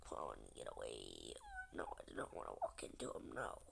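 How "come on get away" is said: usual speaking voice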